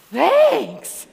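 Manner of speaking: put-on high, loud, awestruck child's thanks; imitating pure childish gratitude; amazed, grateful little girl. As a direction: gush it like a wide-eyed toddler. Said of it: "her eyes get big and she goes".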